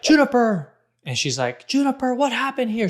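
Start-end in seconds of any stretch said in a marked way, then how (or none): 0.0-0.7 put-on high, loud cry of a child's name; mock angry mom yell; imitating angry wife. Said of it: "she's angry, and she's like". 1.7-2.9 high-pitched, upset mom demanding an answer; mock upset mom; imitating upset wife. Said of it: "She's very upset".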